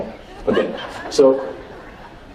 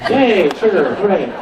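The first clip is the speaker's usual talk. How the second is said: higher, bright-eyed mock "yay" for sugar; mock cheer; 6 semitones higher than usual. Shown as a demonstration. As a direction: cheer for sugar like a kid who got candy. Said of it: "like this".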